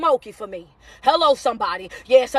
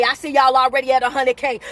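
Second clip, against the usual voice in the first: ordinary talk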